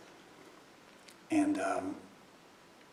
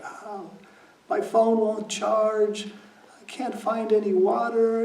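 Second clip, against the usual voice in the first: high, slow, tearful whimpering voice; imitating crying son; 10 semitones higher, 5 dB louder, slower than usual. Stepson sobbing to his dad about a dead phone, high tearful whimper. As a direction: play the stepson sobbing that his world is falling apart. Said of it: "he comes out of his room crying".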